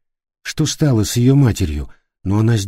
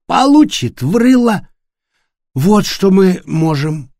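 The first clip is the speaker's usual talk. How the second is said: put-on loud drunken rage, higher; drunken fury; 7 semitones higher, 4 dB louder than usual. A narrator's reading. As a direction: rant drunk and furious. Пьяный Флориан угрожает. (пьяная ярость)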